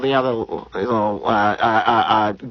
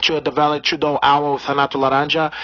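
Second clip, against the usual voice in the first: put-on Brazilian accent. Comedian holding a put-on Brazilian accent.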